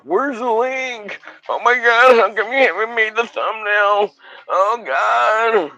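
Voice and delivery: high, loud, frantic mimic; mock the kid freaking out; panicky kid impression. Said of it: "starts freaking out on me".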